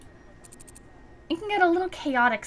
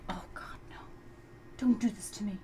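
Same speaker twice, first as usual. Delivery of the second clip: dreading whisper; 9 dB quieter than usual. Acted. Dreading being introduced.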